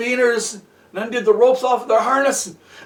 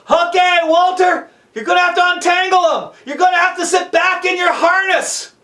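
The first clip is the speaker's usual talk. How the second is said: raised, high-pitched shouted instructions; yelling down; 6 semitones higher, 4 dB louder than usual. Acted. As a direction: project orders down to someone far below. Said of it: "Jonathan yelled down".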